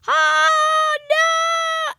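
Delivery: high loud panicked shout. Retold or imitated. Driver crashes through the film set, shouting in panic. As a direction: yell it as you lose control.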